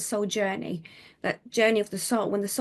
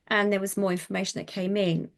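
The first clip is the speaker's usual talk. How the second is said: ordinary talk, nothing marked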